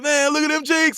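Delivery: high-pitched excited mock outburst; going off. Friend going off over the cheeks at the contest, high mock excitement. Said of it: "So he's going off".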